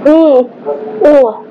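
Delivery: mumbling through chewed food; humming while eating; chewing with mouth closed. Real, not acted. Seen 0: food enters mouth at -2.0; closed, working lips through 3.2.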